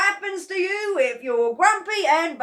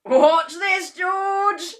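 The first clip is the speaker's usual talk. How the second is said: louder, higher shout of an excited husband; gleeful shout; 12 semitones higher, 13 dB louder, much slower than usual. Acted. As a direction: call out to George with glee. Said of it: "Mister Cranky shouted".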